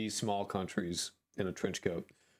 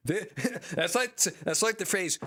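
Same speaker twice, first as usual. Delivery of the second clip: loud, high, real laughter through the words; laughing while talking; 8 semitones higher, 5 dB louder than usual. Real, not acted. Streamer cracking up at a garbled sentence, laughing.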